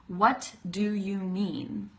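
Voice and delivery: slow, every word separated. Shown as a demonstration. Teacher showing learners the effortful way, separated words. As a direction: stop between each word, sounding all the consonants. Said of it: "how much I have to stop in between the words".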